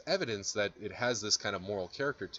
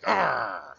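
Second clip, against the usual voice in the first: loud, high "argh" of pain; mock yelp from a trodden foot; acted pain cry; 6 semitones higher, 8 dB louder than usual. Acts student's pain when stepped on.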